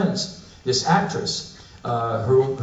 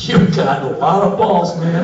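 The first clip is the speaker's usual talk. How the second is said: slow amused mock warning; drawn-out knowing jab at the traveler; look that says; 5 semitones higher, 5 dB louder than usual. Customs officer eyeing the American passport, slow mock warning. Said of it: "gives me this look that says".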